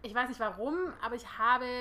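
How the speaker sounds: normal talking voice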